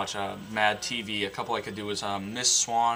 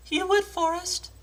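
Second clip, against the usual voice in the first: much higher falsetto woman; mock Jenny voice. Jenny answering Forrest, high falsetto. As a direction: squeak it out as Jenny. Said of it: "Now for Forrest Gump".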